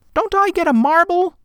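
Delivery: put-on high boy, pleading; a boy asking for his marble; child voice. A narrator's reading. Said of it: "the boy asked".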